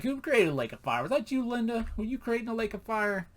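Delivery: high, singled-out teasing questions; hammed-up high-voiced incredulity; mock questioning. God teasing about lake of fire.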